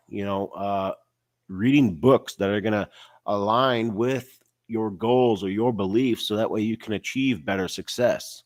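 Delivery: usual speaking voice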